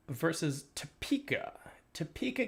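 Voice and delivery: hushed whispered reveal; whispering. Acted. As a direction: whisper it like a secret. Dramatic answer reveal.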